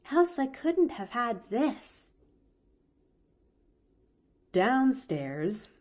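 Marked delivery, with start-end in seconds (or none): 0.1-2.1 lightly voiced little girl, higher; a girl drifting off, content; sleepy young girl. A narrator's reading. Girl on the roof drifting to sleep, lightly voiced. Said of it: "she murmured".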